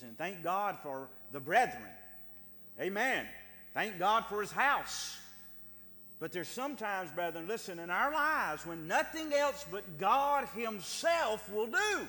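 loud, high, slowed preaching climax; preaching at full force. Real, not acted. Preacher rousing the congregation, loud and slow. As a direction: preach it home to the brethren.

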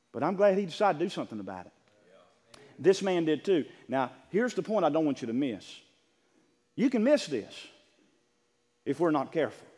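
normal talking voice

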